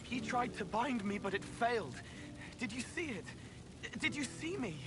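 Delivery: high, breathless-with-news exclamation; excited. Acted. Companion telling how the binding failed, excited. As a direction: burst out with it, high and eager. Elated after resisting a binding.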